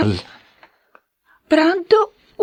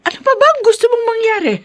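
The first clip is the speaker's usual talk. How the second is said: exasperated outburst; faster than usual. Acted. Mother confronts son over brother.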